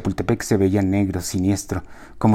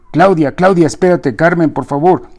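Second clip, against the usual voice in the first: much higher and louder, begging them to wait; pleading shout; 7 semitones higher, 6 dB louder than usual. Acted. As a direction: shout after the car, pleading. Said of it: "gritaba Vicente".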